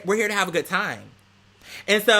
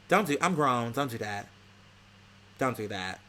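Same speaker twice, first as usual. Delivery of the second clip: low firm scolding; 7 semitones lower, 6 dB quieter than usual. Real, not acted. Telling off the friend.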